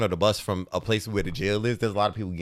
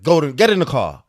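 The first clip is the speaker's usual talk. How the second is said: stern order; 6 semitones higher, 10 dB louder than usual. Retold or imitated. Aunt ordering him into car.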